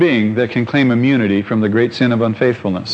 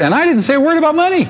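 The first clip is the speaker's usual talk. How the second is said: much higher, fast, astonished insistence; amazed aside; 11 semitones higher, faster than usual. Real, not acted. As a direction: blurt it out, amazed it happened. Emphatic aside, amazed at God.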